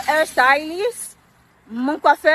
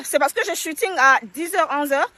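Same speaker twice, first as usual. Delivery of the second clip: ordinary talk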